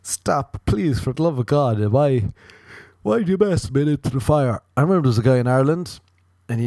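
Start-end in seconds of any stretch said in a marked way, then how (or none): 0.0-4.6 exasperated cat. Pretend. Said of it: "And the cat's like".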